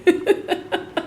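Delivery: high, soft chuckle to oneself; light, airy giggle; amused laugh. Real, not acted. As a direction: laugh at your own funny retelling. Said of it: "That was so funny".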